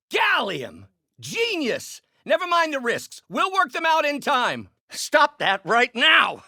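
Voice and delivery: high-pitched, exclaiming scientist; excited outburst. Acted. Scientist hitting on a breakthrough material, excited outburst. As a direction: burst out high, ending in a loud order.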